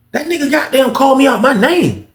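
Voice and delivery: high-pitched protest, retold; indignant self-defence. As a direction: argue your case, pitch jumping way up. Retold quarrel, defending himself.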